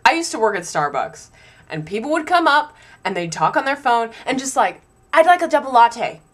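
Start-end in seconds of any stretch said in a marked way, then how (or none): 5.1-6.2 mock customer. Mimicking phone customer.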